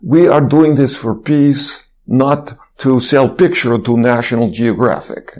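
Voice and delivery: slow, imitated Russian-accented speech; earnest mock Russian accent. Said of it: "speech in his Russian accent".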